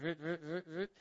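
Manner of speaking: soft imitated ratchet sound; socket wrench noise. Husband retelling girlfriend fixing his car, soft ratchet imitation. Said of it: "I hear the socket set".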